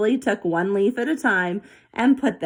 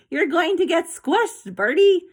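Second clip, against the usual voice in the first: higher, louder desperate call; desperate yell; 9 semitones higher, 3 dB louder than usual. A narrator's reading. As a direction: yell a frantic warning. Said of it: "Zoe yelled desperately".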